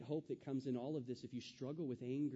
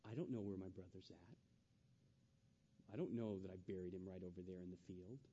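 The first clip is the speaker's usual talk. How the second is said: sly deceptive Cain; 8 semitones lower, 8 dB quieter than usual. Acted. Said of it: "the deception of Cain".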